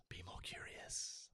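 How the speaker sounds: soft, slow, breathy whisper; whispered aside. Real, not acted. Coach whispering a tip to consultants, slowly and softly.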